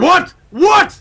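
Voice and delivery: high, loud incredulous what; mock outrage. Host told they must lose the S, high mock-indignant disbelief. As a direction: squawk it in exaggerated disbelief. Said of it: "And I was like".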